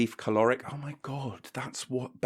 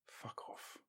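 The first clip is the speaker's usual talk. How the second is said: breathy whispered fuck off; whispered aside; 25 dB quieter than usual. Acted. Brushing off his co-host, he whispers a dismissive aside. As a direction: mutter it under your breath.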